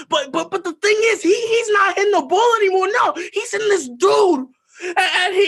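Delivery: put-on teen confessing the beating, frantic shout; frantic retelling. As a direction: spill it out, spiralling out of control. Retelling beating, rising frenzy.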